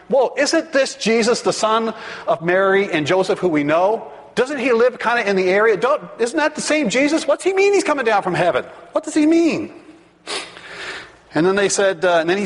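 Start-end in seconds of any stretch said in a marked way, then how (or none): 0.1-9.7 high-pitched, mounting complaint; indignant grumbling. Acted. Crowd arguing over who Jesus claims to be, indignant complaining. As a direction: argue back like an affronted crowd, climbing higher. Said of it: "they grumble and complain".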